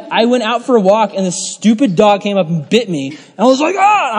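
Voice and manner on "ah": high-pitched retold yell of "ah"; yelled cry. Preacher reenacts being bitten by a dog, high yell. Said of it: "I was actually yelling".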